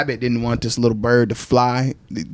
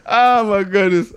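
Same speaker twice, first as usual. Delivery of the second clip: laughing; 7 semitones higher, slower than usual. Real, not acted. Still laughing while talking.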